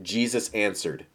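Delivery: usual speaking voice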